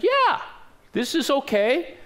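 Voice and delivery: high-pitched, too-sure reassurance; breezy assurance. Retold or imitated. Young guide answering "Are you sure?", breezily confident. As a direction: brush off the doubt with a high lilt.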